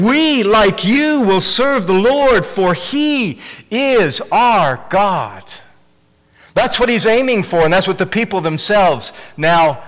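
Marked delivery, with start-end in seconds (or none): 0.0-5.4 slow, high, resounding affirmation; emphatic affirmation. Retold or imitated. The people pledging to serve the Lord, slow and affirmative. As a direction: affirm it slowly, stretching out the words. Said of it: "It's affirmative".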